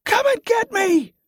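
high, taunting scream. A narrator's reading. Jordan baiting the mob to chase him, high screaming. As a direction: scream a reckless challenge at the top of your range. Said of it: "Jordan screamed".

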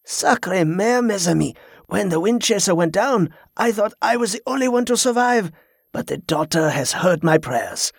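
rejoice as the French pirate; exultant. A narrator's reading. Pirate finds his friends alive, exultant. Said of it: "sounding genuinely exultant".

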